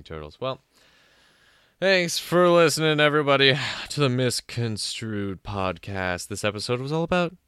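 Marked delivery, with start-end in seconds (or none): 1.8-6.3 loud sign-off. Real, not acted. Podcast sign-off.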